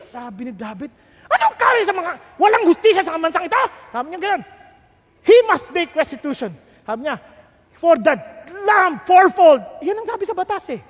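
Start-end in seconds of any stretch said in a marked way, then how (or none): 1.3-3.6 fast, loud furious shout; angry king. Retold or imitated. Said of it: "galit si David". 5.2-9.6 much louder, stern pronouncement; angry verdict. Retold or imitated. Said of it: "galit si David".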